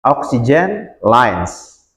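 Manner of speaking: Oxygen lines said very slowly, lines drawn out; slow model. Shown as a demonstration. Teacher to students, modelling Oxygen lines for repeating.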